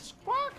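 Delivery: high, loud spoken squawk; mock parrot cry. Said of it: "I'm doing a parrot voice acting now".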